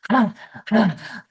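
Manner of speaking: loud, voiced gasps of relief after running; relieved panting sighs. The body reaching the safe cave, relieved voiced exhales. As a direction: breathe out hard like you just reached safety. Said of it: "you're like".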